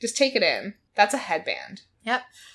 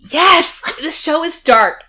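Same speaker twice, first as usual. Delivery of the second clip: excited; 7 semitones higher, 7 dB louder, slower than usual. Real, not acted. Excited emphatic agreement.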